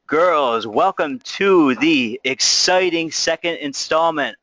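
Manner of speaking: slow, stretched-out announcer voice; hammed-up grand welcome like a kids' show host; drawn-out mock-announcer greeting; showman's opener.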